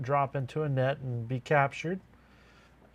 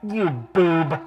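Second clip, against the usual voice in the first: sneering villain voice through an echo mic; Skeletor voice; 4 semitones higher, 9 dB louder than usual. Acted. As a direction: taunt like a cartoon villain. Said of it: "famous for his Skeletor voice".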